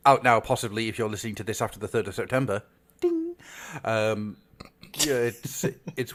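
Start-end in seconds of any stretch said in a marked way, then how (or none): 3.0-3.4 mock bell ding. Jokey sound effect.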